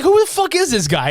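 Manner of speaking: high-pitched indignant demand; mock offended. Brother confronting his sister's boyfriend, mock offended. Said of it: "this man is so offended".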